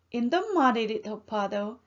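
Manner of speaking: ordinary delivery, nothing marked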